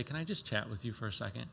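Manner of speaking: quick and soft, a gentle invitation; soft gentle ask. Pretend. Going lovingly to that person, soft and quick. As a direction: ask lightly so they feel safe.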